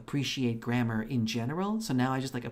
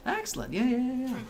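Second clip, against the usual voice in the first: mock-posh excellent; 12 semitones higher than usual. Real, not acted. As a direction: purr the word like a pleased villain. Exaggerated high reply to chat.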